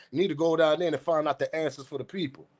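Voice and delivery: usual speaking voice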